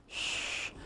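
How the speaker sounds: long hissed "shh"; unvoiced hush; shushing. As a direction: shush a noisy complainer. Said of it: "I went".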